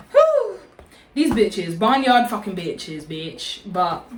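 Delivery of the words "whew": very high whooping exhale; exasperated whew. Real, not acted. Cooling off after the bike rant, high whew.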